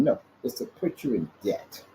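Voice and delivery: ordinary delivery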